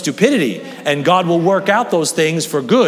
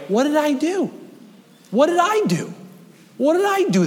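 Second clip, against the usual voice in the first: God pleading; 12 semitones higher than usual. Acted. God asking as people leave.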